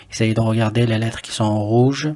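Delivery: usual speaking voice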